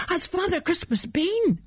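put-on a high, eager child's question. A narrator's reading. A little boy eagerly asking his father about Christmas. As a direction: rush the question out, hopeful.

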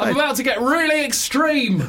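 high, hammed-up call; mock hyped-up announcement. Metal fan warning the mosh pit, mock excitement.

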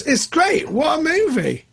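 high-pitched gushing; enthusiastic praise. Real, not acted. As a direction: exclaim it high, full of admiration.

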